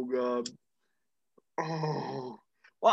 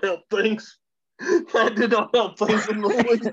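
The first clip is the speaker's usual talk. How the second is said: helpless laughter; 5 semitones higher, 5 dB louder than usual. Real, not acted. Cracking up at friend's remark.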